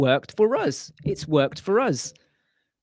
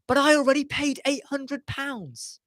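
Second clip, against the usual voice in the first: high, mock-whining protest; mock whiny client; 10 semitones higher than usual. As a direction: whine like the cheap client.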